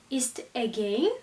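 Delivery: usual speaking voice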